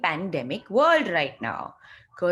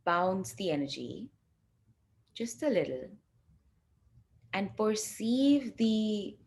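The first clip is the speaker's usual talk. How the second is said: guided meditation; 5 dB quieter than usual. Acted. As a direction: ease the group into the exercise gently. Leads a guided energy exercise.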